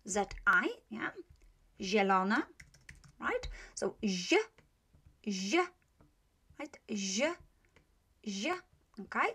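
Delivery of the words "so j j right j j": "j" as a soft, light zh; pronunciation demo. Shown as a demonstration. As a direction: isolate "j" as a soft zh for learners. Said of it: "this one is j".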